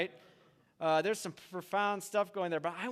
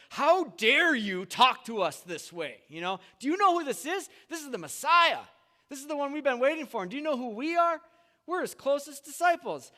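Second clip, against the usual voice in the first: higher, louder hammed-up outrage; offended, indignant; 8 semitones higher, 6 dB louder than usual. James and John indignant at the Samaritans, a hammed-up outraged rant. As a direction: puff up like insulted insiders. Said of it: "They're, like, offended".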